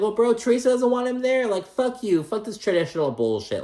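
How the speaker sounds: high-pitched, worked-up comeback, easing down; indignant retort. Pretend. Host argues back at Joe over the wedding, indignant. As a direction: fire back in heated protest.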